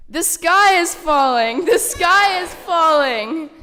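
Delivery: very loud, high, slow panicked yell; panicked scream. Acted. Storyteller and children yelling that the sky is falling. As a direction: scream it slowly, all out. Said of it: "screamed as they ran".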